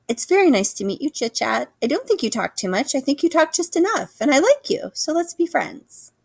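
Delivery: a gosling kindly befriending the squirrel; gentle gosling. A narrator's reading. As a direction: offer friendship kindly.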